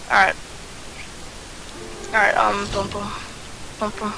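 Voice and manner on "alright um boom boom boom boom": ordinary talk, nothing marked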